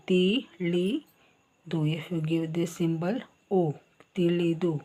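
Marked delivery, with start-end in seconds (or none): 0.1-1.8 slow demo. Shown as a demonstration. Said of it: "ta plus E".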